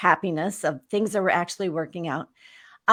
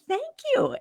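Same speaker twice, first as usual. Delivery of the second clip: high, emphatic thank you; finally, someone agrees; relieved thanks. Retold or imitated. Relieved when friend believes her.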